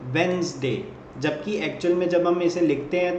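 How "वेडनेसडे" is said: वेडनेसडे as Wenz-day, first d dropped; वेडनेसडे said Wenz-day as a memory aid; correct pronunciation. Shown as a demonstration. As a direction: demonstrate वेडनेसडे as two syllables. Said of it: "pronounce इसे कैसे करते हैं".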